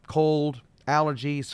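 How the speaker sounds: ordinary talk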